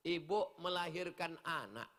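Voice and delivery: ordinary talk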